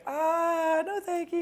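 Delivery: put-on high, polite girly refusal; girl's voice. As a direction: turn him down sweetly. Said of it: "She's like".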